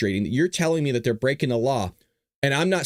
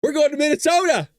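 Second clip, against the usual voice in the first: much higher and louder, triumphant big reveal; excited dad announcing; 13 semitones higher, 5 dB louder than usual. Retold or imitated. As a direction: announce the trip like a grand prize. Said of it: "who's asking".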